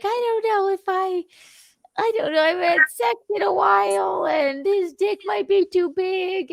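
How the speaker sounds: high, quick nervous friend voice; imitating nervous friend. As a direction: fret like an anxious friend. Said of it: "she's like".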